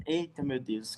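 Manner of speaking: normal talking voice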